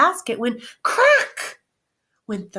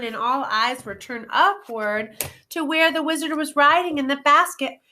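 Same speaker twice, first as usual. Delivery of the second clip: plain reading voice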